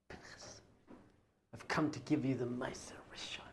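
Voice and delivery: hushed whisper. Retold or imitated. Approaching Rav Pinchas in the shop, whispering reverently.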